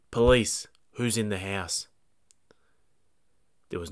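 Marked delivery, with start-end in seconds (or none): 0.1-1.9 loud police shout into the house; yelled call-out. Retold or imitated. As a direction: bellow it through the open door. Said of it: "yelled out".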